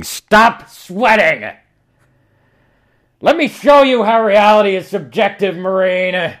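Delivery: stretched high furious shriek; screaming Examiner. Acted. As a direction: scream it like a madman.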